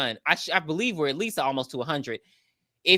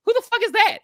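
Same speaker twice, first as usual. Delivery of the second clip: much higher, outraged confusion; shocked disbelief; 6 dB louder than usual. Retold or imitated. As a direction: hit it high like you can't believe it. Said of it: "they're like".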